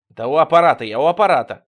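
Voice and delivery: loud, insistent phone reply; impatient answer. A narrator's reading. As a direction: insist loudly that you're on the line. Answering the repeated call on phone.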